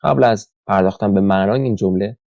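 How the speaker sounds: ordinary talk, nothing marked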